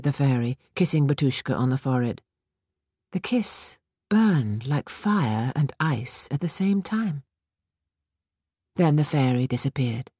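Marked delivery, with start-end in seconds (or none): none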